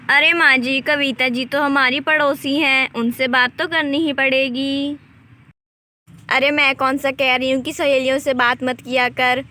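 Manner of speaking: ordinary talk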